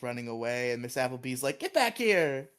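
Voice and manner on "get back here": teacher calling. Retold or imitated. Teacher calling after students.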